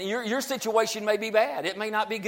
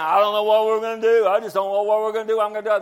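quick, louder fretful rush of mock worry; worried fretting; 2 semitones higher, 6 dB louder, much faster than usual. Believer frets aloud to a friend, quick mock panic.

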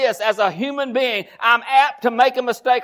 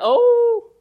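high startled oh; mock gasp; 13 semitones higher, 4 dB louder than usual. Believer catching a bad thought, high mock gasp.

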